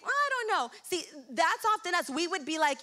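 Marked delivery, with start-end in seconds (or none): 0.0-0.7 high-pitched, doubtful hedging; mock hesitant. People doubting whether change is worth it, acted hesitancy. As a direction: lift the pitch right up, unsure.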